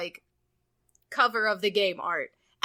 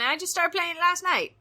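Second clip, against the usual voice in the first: ordinary talk, nothing marked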